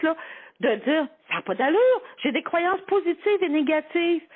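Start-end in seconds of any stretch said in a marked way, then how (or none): 1.3-4.2 higher-pitched imitation of baffled participants; mock incredulous protest. People doing the belief exercise discover contradictions, mock incredulous. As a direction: protest as if it makes no sense.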